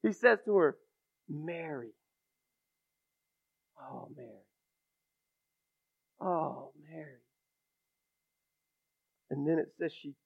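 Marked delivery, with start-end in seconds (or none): 1.3-1.9 soft, hushed name; Jesus calling Mary by name, tender and quiet. Acted. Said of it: "The compassionate Jesus". 3.8-7.2 tender. Acted. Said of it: "preacher savoring the name".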